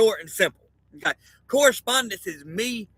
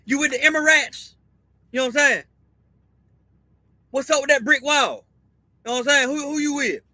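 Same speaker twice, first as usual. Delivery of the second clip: pitched up, pushy questions to a stranger; nosy street guy; 5 semitones higher than usual. Retold or imitated. Mimicking a guy bluntly asking a stranger what team he's on, pitched up. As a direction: play a guy fishing for whose side you're on.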